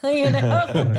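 laughing through the words; amused real laugh. Real, not acted. Laughing over blogging start.

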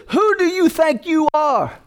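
high, slow scornful challenge; scornful devil. Retold or imitated. Devil challenging the believer, high and slow. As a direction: sneer the question up high. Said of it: "the devil says".

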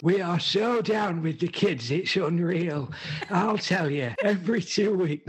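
put-on high, slow hammy cool-kid voice; silly high put-on voice. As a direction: ham up being down with the kids. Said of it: "I will do it in my normal voice".

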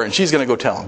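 ordinary talk

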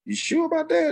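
high-pitched, checking question; imitating double-checking friend. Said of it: "He had to make sure".

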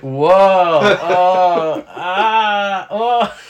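startled cries of someone falling; falling sounds. Pretend. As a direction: yelp as if tumbling down. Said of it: "he's doing an impression of someone falling".

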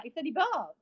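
ordinary delivery

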